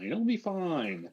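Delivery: high, drawn-out singsong; singsong reassurance. Pretend. Friend waving away the unpaid subscription, singsong reassurance. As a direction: stretch it out high in mock reassurance.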